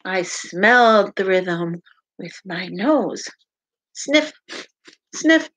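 lively, sing-song picture book read-aloud; animated storytime voice with playful sound words; reading to children. Acted. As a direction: read it out to little listeners with bounce.

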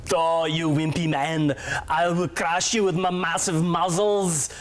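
heavy Austrian action hero voice; Arnold impression. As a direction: boom it like Schwarzenegger. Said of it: "I'll do an impression too".

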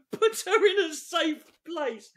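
high, slow, incredulous repeat; amused disbelief. Real, not acted. Comic repeats the guide's weird step, incredulous and amused.

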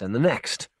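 usual speaking voice

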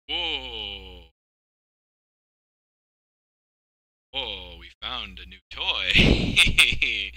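excited. Real, not acted. Discovers new tool.

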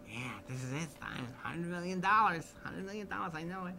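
lightly voiced Jimmy, starry-eyed; imitating Jimmy. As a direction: savour the hundred million like a dream.

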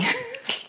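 high, helpless laugh. Real, not acted. Vlogger cracks up at own confusion, laughing. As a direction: burst out in a high-pitched laugh.

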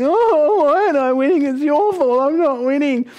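put-on high, loud whiny teen complaint; shrill-free high whinge, loud and far up; whining teenager. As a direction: whine like a sulky teenager to his mum. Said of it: "the whining seventeen-year-old".

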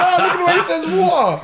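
high-pitched mimicry through laughter; imitating her laughing, high voice; amused daughter. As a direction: squeal it like a girl cracking up. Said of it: "She's going".

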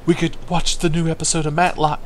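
lightly voiced hopeful old geezer; lonely old man. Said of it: "He's like".

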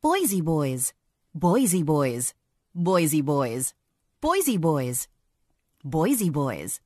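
punchy promo announcer; TV promo read. Shown as a demonstration. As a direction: sell the show title. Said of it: "Like this".

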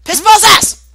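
high, loud outburst of swearing; frustrated cursing. Real, not acted. Player swearing at a failed move, frustrated cursing. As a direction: curse the game out loudly.

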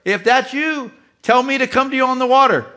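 high, loud, pleading call across the water; Peter calling out. Retold or imitated. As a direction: call out to Jesus from the boat. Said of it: "Can you imagine Peter saying".

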